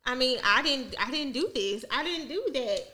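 normal talking voice

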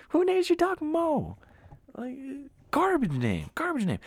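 high, loud, drawn-out incredulous cry; outraged disbelief. Real, not acted. Ranting about the neighbor's dog name, high exasperated disbelief. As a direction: squeal it in exasperation, stretching the words.

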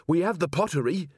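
high, hoarse insistence. A narrator's reading. Carrot offering to mend the golem, hoarse. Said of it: "said Carrot hoarsely".

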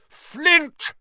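high, loud angry bellow; furious full-voiced call. A narrator's reading. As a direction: bellow the name across the pitch. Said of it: "Wood bellowed at the Slytherin captain".

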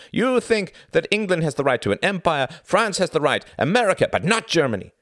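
high, loud tirade of scorn; suddenly scornful. A narrator's reading. Klaus rails at Tom about Allied hypocrisy, scornful. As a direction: build into a rapid, indignant rant. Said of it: "his voice scornful all of a sudden".

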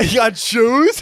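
high, loud, drawn-out question; mock disbelief. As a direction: ham up the amazement. Joking disbelief about bowling.

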